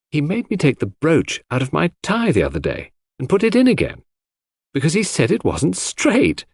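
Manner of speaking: a hint of a cheerful young nurse, amused; lightly voiced young woman. A narrator's reading. Cynthia chatting about Poirot.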